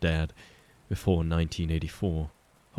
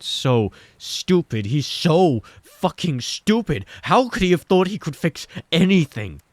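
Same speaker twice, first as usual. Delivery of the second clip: builds from slow to a shouted, much higher outburst; climbing to a bitter yell; rising self-loathing; 11 semitones higher, 7 dB louder than usual. A narrator's reading. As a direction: spiral into furious self-hate. Mike's self-hating spiral, rising.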